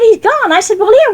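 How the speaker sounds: ordinary delivery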